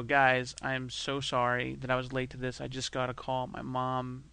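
quietly mock-somber announcement; fake somber. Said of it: "come into a room and go".

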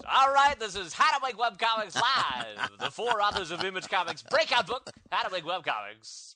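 Brad voice. Acted. Host mimicking his co-host's reading of the talk listing, hammed-up Brad voice. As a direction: belt it out like an over-the-top showman. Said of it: "read it in true Brad voice".

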